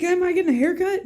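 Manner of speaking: a hint of an eager little boy asking; nephew's voice. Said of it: "He's like".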